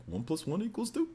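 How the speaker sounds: lightly voiced cartoon bear with rising end; a hint of cartoon bear, answering in class; Yogi Bear. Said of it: "I kept on saying".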